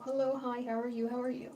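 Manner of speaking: normal talking voice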